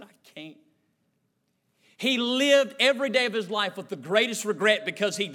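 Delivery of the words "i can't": very quiet, low defeat; defeated admission. Retold or imitated. Man who cannot afford his car, quiet defeat. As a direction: let it drop almost to nothing.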